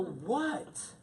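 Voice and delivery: baffled at her hint; confused what. Retold or imitated. To his wife, puzzled at her cryptic reply.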